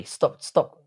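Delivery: usual speaking voice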